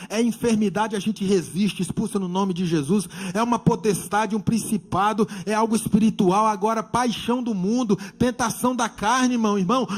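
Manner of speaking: loud, driving pulpit list; forceful preaching. Real, not acted. Preacher telling church to flee temptation, loud urgent exhortation.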